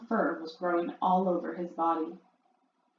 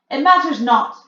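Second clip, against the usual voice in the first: louder, dismissive shout; 6 semitones higher, 12 dB louder than usual. A narrator's reading. Grand High Witch shouting to the witches, dismissive. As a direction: wave it away loudly. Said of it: "shouted the Grand High Witch".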